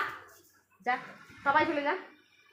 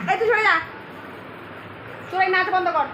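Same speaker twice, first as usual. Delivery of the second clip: shooing; 4 semitones higher than usual. Real, not acted. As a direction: shout the cat off the game. Shooing the cat away. (বিড়ালকে তাড়িয়ে চিৎকার)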